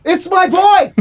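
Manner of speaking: high-pitched indignant yell; mock yell. Shouting back at the car radio, mock yell.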